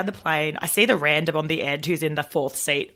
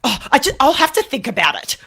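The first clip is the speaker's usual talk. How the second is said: whiny stranger; 8 semitones higher, 4 dB louder than usual. Retold or imitated. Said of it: "he's like".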